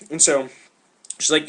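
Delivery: ordinary talk, nothing marked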